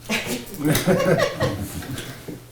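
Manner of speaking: high bursting laugh at his own joke. Real, not acted. Laughing at his own joke about old reports, high burst. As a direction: let a real laugh break out.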